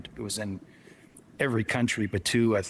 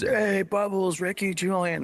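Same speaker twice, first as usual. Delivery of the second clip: imitating fans calling out, higher pitch; high-pitched call, like a half-volume yell from a window; mock yelling; 8 semitones higher than usual. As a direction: pretend to holler at someone you recognize. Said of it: "yelling out the windows".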